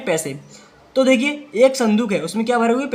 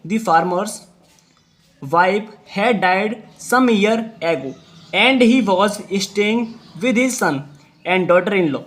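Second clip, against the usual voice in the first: very slow, word by word; slow dictation; much slower than usual. Shown as a demonstration. Teacher dictating sentence to pupils, very slow. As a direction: read it slowly for the class. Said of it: "जितनी speed से मैं बोलूँ".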